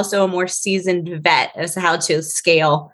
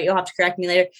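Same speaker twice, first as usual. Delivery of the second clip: ordinary talk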